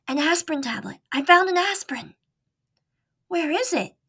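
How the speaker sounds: high, eager little mouse voice. Acted. Mouse voiced higher than narration.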